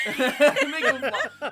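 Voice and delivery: words squeezed out through laughter; laughing. Real, not acted. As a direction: say it while cracking up. Repeating joke while cracking up.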